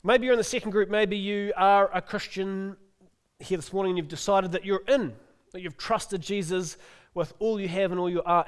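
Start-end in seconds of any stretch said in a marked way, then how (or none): none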